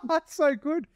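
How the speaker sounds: high, laughing burst then the words; delighted laugh spilling into the line; real laughter. Real, not acted. Host cracking up at the retreat story.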